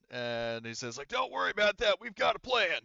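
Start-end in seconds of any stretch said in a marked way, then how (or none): 1.1-2.8 loud, high drill sergeant voice; Horgus. Acted. Horgus tells the captain of a plan, loud drill sergeant. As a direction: raise pitch and volume like a drill sergeant. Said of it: "voicing Horgus basically as, like, a drill sergeant".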